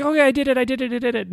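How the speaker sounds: high, excited burst as the student; imitating fired-up excitement. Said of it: "she was all fired up".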